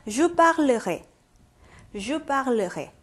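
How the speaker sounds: Je parlerai in evenly separated syllables, falling at the end; repeat-after-me model. Shown as a demonstration. Teacher asks students to 따라해보세요, measured pronunciation model. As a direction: model Je parlerai slowly for repetition. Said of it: "다시 한번 따라해보세요".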